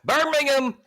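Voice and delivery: high, loud whoop for the pick; excited cheer. Real, not acted. Host celebrating Light Bright's pick, excited cheer.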